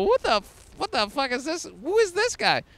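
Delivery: a bystander's startled outburst; shocked stranger. Retold or imitated. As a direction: react like a stranger spooked by you. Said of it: "they're gonna go".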